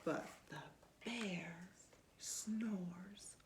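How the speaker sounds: put-on lively read-aloud to a child; storybook reading. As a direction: read it like bedtime story time. Reading picture book aloud.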